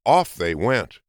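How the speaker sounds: usual speaking voice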